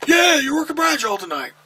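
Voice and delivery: high, loud announcement; retold shout. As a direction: yell it like a verdict. Repeats the assignment, yelling.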